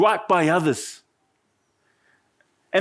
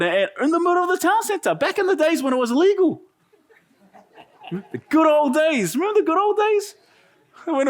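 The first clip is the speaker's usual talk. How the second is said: high, laughing mock nostalgia; 9 semitones higher than usual. Real, not acted. Preacher to congregation, laughing high about the old days.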